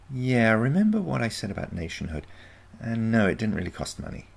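a touch of a confident granddaughter; teenage granddaughter. A narrator's reading. Marina answering the narrator.

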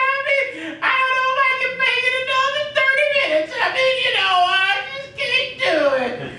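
high, wailing, drawn-out caricature of a miserable prayer; hammed-up tearful plea, stretched long; mock gloomy praying. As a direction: moan and whine it out like a sufferer begging God. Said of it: "they always talk like this".

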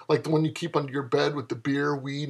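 ordinary delivery

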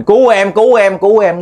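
high, loud panicked cry for help. Retold or imitated. Terrified man shouting to his brothers for help. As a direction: yell out for help in terror. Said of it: "ổng la lên".

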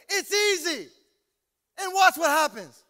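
loud, high preacher's burst; exhorting shout. Real, not acted. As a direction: call it out to the crowd. Preacher urging congregation, calling out.

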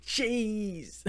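high, soft, drawn-out cartoon squeal; cartoon imitation. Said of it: "same thing that they do in Chicken Run".

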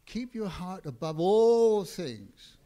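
loud, slow, ringing proclamation; proclaiming scripture. Acted. Preacher to congregation, loud slow scripture proclamation. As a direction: declare the verse to the whole room.

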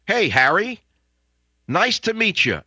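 high-pitched, pointed pretend hello; mock greeting. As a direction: greet an absent politician with mock friendliness. Mock introduction to absent Harry Reid.

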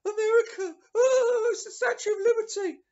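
very high, loud gushing; hammed-up squeal of tourist wonder; mock starstruck awe. Singer mocked on going to America, hammed-up starstruck gushing. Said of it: "he's acting like".

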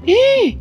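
sharp high gasp of shock; dramatic gasp. Retold or imitated.

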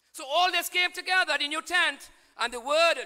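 loud, high preaching that builds to a shout; rising pulpit shout; full-force preaching. Acted. Preaching climax resumes.

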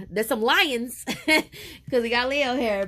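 slow, giggling through words; laughing. Real, not acted. Reader laughing at her lions joke for Leo.

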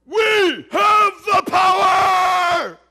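booming, drawn-out superhero battle cry; over-the-top heroic proclamation, every word stretched. Acted. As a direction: shout it high and slow, at full blast. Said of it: "say it like a superhero".

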